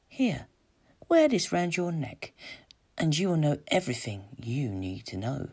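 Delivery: put-on soft, luring young temptress; soft enchantress. A narrator's reading. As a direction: coax him gently as an enchantress. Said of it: "said a soft voice at his ear".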